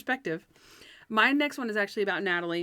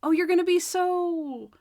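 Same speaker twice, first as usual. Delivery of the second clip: mock cheery Natalie; 8 semitones higher, 4 dB louder, slower than usual. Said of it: "she says".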